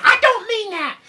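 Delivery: very high, loud exasperated mom; scolding mother. Acted. Mother scolding Jack over wet pants, exasperated. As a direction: screech at your son in mock dismay.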